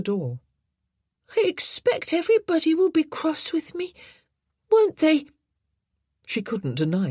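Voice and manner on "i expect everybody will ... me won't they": put-on worried boy. A narrator's reading. Boy worried about punishment.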